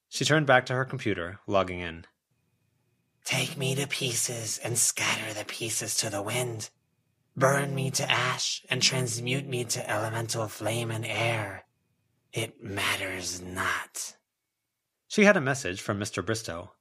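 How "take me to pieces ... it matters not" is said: slow, hollow, unearthly pronouncement; eerie mummy voice. Acted. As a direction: intone it like an ancient dead thing, calm and indifferent. Mummy voice across several lines.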